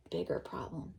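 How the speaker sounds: ordinary delivery, nothing marked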